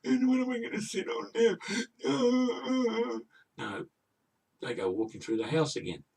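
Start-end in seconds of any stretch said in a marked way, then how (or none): 0.0-3.2 hammed-up high, loud baby wail; mock baby crying. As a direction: bawl it slowly like a tiny bear having a pretend meltdown, drawing out every word into a sob. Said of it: "instead of crying like a baby should do".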